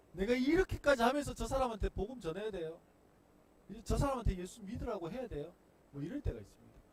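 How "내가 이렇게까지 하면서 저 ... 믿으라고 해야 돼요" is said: high, loud frustrated grumble; fed-up loud protest; exasperated complaint. Pretend. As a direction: vent the frustration at God. Evangelist complaining to God.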